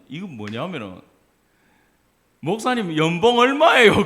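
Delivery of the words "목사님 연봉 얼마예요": high, loud mock interrogation; imitating a blunt questioner; loud nosy questioning. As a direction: fire it off as a prying question. Mocking nosy questions put to pastors.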